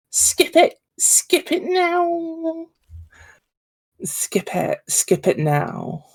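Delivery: put-on high, drawn-out Valley Girl whine; imitating a bubbly sorority girl; Valley Girl accent. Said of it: "a Valley Girl accent?".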